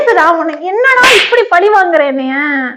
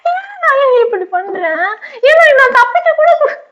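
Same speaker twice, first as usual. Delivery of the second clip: sobbing; 6 semitones higher than usual. Acted. Poisoned wife wailing at husband.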